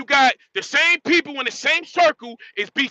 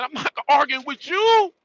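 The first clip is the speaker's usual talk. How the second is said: normal talking voice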